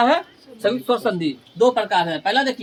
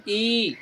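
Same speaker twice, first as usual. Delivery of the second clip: ई drawn out long; held long vowel; 5 dB quieter than usual. Shown as a demonstration. Teacher giving long-vowel examples, ई drawn out. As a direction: hold ई as one steady vowel. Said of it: "अधिक समय लगता".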